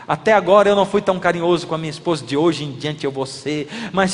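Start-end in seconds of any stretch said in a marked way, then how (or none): none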